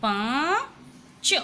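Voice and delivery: पाँच drawn out slowly with a nasal आँ. Shown as a demonstration. Teacher models the word पाँच slowly for the children. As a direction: stretch पाँच, vowel through the nose. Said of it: "जब आप भी बोलोगे ना इसको".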